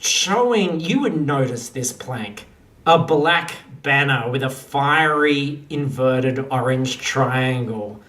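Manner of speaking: normal talking voice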